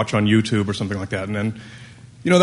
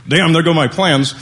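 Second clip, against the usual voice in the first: mock dismay; 6 semitones higher, 5 dB louder than usual. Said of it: "And I said".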